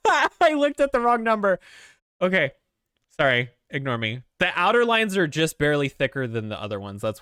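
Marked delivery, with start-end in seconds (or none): 0.0-2.5 high, loud words through laughter; real laughing. Real, not acted. Owning up to a silly drawing mistake, laughing hard. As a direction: burst out laughing while talking.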